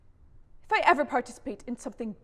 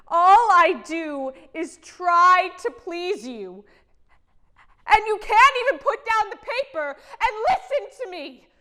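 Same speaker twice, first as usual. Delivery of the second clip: loud, high, anguished yelling; emotional outburst; 7 semitones higher, 13 dB louder than usual. Acted. Wife begging husband to put down the paper, emotional yelling. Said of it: "I'm getting emotional".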